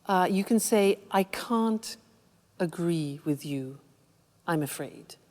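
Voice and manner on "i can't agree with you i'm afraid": soft, low, polite refusal; courteous, softened objection; polite disagreement. Shown as a demonstration. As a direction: disagree politely, dropping lower and quieter. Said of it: "To be polite".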